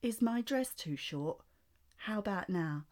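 slow, drawn-out posh British voice. Retold or imitated. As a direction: stretch the posh British pout out slowly. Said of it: "do it again, but slower".

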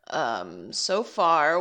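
normal talking voice

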